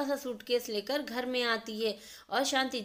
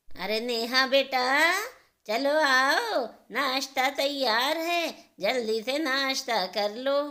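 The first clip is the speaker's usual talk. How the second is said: louder, slow mother calling across the house; 6 dB louder, slower than usual. Acted. Mother calling Neha to come eat breakfast, loud and slow. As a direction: call your daughter to breakfast.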